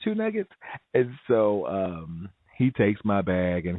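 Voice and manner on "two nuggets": incredulous echo. Retold or imitated. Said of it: "I didn't say it".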